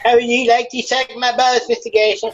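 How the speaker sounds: high, mocking put-on voice. Friend teases the host in a high mocking put-on voice. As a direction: taunt in a silly high voice. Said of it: "you do that way too well".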